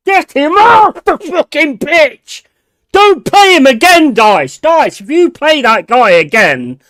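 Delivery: real angry outburst; angry shout. Real, not acted. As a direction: bellow it at the manager in real fury. Fan raging at substitution.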